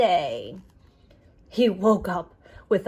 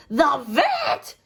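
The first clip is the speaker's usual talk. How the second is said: higher, alarmed shout; 4 semitones higher, 3 dB louder than usual. Acted. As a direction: yelp it in horror. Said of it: "shouted Titus".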